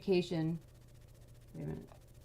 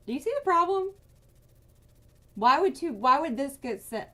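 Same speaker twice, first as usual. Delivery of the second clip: much higher and louder, incredulous; furious outburst; 7 semitones higher, 6 dB louder than usual. Real, not acted. Asking viewers why the bill was sent there, furious and loud. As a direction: demand an answer, boiling over. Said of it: "I'm pissed now".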